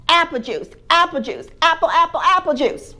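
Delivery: put-on high, loud whiny toddler chant; baby voice. Little baby to his family at breakfast, whiny high demand.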